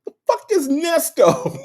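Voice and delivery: very high-pitched squeal of disbelief; incredulous scorn. Real, not acted. Host dismissing Nesto as a nobody, incredulous high squeal. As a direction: squeak it out as a punchline about to laugh. Scornful punchline, laughs after.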